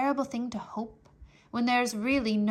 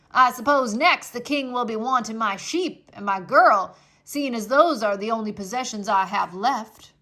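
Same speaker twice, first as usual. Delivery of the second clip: a lightly voiced put-upon old man, loud and bitter; grumbling Uncle; 10 dB louder than usual. Uncle griping at the king's soldier, loud and sour. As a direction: complain it loudly, sulking.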